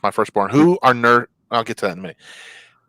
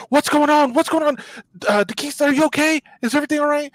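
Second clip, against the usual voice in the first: high, hurried nurse voice; a nurse rushing in to check; worried nurse. Retold or imitated. Nurse rushing in to check on the patient, high worried voice. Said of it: "She goes".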